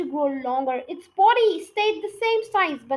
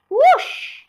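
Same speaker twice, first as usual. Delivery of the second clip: much higher, louder squawk of a chicken; chicken's squeak; 10 semitones higher, 10 dB louder than usual. A narrator's reading. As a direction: squeak it like a startled hen. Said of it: "squeaked the chicken".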